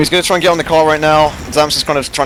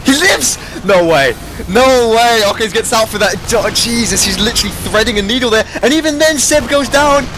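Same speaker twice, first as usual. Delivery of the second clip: excited; 10 semitones higher than usual. Real, not acted. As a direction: yell it like a clutch save. Caster reacting to a clutch escape.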